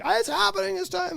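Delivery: put-on high whiny kid voice, very high; little kid voice. Dad imitating his toddler son's urgent need. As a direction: squeak it like a desperate toddler.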